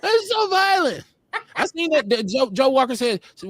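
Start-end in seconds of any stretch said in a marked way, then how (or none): 0.0-1.0 mock shocked.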